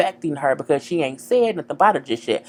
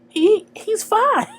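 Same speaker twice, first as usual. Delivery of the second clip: exasperated; much slower than usual. Pretend. Exasperated at Darla's fuss.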